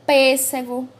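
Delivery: "Pêssego" with a loud, closed first E; closed vowel. Shown as a demonstration. Teacher giving an example of the circumflex, closed stressed E in "Pêssego". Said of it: "indica o som fechado nas palavras".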